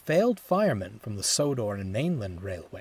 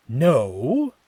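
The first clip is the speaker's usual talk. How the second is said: exasperated; 2 semitones higher, 7 dB louder than usual. A narrator's reading. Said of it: "sighed, exasperated".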